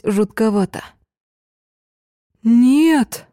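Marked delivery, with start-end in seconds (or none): 2.4-3.3 high, louder shocked no; shocked cry. Acted. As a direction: shout it in disbelief. Friend cries out in shock. (потрясённо)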